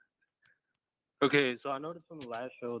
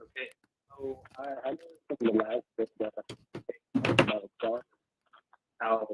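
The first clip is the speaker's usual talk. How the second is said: bad connection. Real, not acted. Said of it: "we can't hear you, bro".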